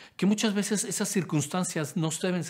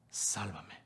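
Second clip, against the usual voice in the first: whispered plea; 6 semitones lower, 10 dB quieter than usual. Acted. Pedro sinking, cries for help.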